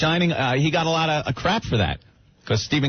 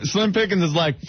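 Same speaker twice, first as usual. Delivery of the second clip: usual speaking voice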